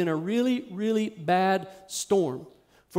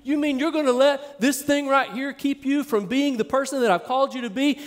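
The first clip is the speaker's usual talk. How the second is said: higher-pitched, driving sermon delivery; impassioned preaching; 5 semitones higher than usual. Acted. Preacher voicing Jesus's rebuke to doubting disciples, impassioned preaching. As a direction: build repeated phrases like a fired-up preacher.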